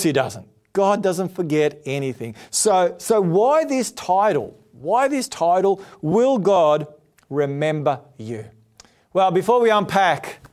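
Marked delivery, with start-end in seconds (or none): none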